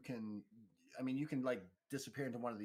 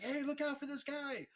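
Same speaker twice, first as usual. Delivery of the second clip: high, squeaky put-on bystander voice; alarmed crowd member; 5 dB louder than usual. Bystander warning the crowd about the brawler, high and alarmed. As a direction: call out a warning in a high mock voice. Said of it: "they're starting to whisper".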